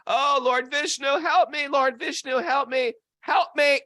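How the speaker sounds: high, loud acted cry to God; desperate plea. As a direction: play a drowning man begging for rescue. Drowning man cries to God.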